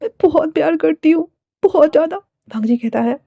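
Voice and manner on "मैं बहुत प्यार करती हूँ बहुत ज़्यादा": high-pitched tearful begging; acted weepy plea, pitched up; tearful pleading. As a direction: plead as if close to tears. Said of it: "फिर रोते हुए कहती है".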